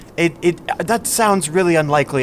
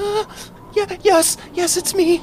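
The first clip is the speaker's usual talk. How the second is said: nervous stammer. Acted. Frightened captive answers at knifepoint.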